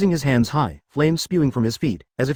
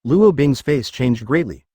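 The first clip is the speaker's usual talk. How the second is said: usual speaking voice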